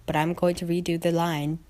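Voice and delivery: ordinary delivery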